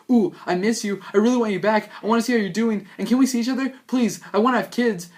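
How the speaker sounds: high, loud desperate pleading; needy. Acted. Ex-boyfriend begging his ex on the phone, high and desperate. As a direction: beg her back, voice climbing. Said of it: "And you're like".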